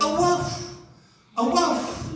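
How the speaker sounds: high, yapping dog saying wolf twice; barking dog. Retold or imitated. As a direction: bark it out like a small dog. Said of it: "it went".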